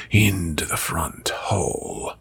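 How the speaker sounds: normal talking voice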